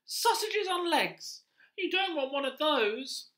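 rat character. Acted. Teacher voicing the pet-shop rat to children, high and loud. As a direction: play the pushy little rat, high and loud.

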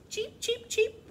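very high, tiny chick cheeps; squeaky baby-bird chirps; peeping chicks. A narrator's reading. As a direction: peep like newly hatched chicks. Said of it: "the chicks peeped".

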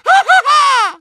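high, loud, maniacal cackle; maniacal laugh. Acted. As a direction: cackle like a mad scientist. Bird head madness.

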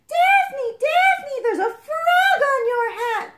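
high-pitched alarmed yell, drawn out at the end; panicked scream. Acted. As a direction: shriek it high and loud. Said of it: "someone screamed".

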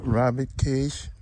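ordinary delivery

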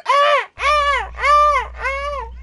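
loud, high repeated yelps; high-pitched mock screams, belted out; imitated screams. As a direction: mimic a man screaming in short bursts. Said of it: "that dude fucking go".

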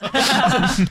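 high burst of real laughter; laughing. Real, not acted. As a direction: laugh out at your own bit. Laughs after old man voice.

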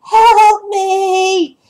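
high, drawn-out cry for help; ghost child's scream. Acted. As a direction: wail it like a ghostly child pleading. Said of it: "screams into your head".

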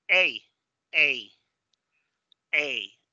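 "A" as English ay, gliding; long A sound. Shown as a demonstration. Teacher modelling fourth sound, English ay. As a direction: say "A" like the ay in day. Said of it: "Y luego este sonido".

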